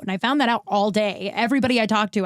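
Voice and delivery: ordinary talk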